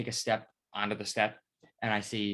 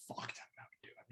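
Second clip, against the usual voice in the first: whispered aside; 19 dB quieter, faster than usual. Real, not acted. Muttered aside about the truck.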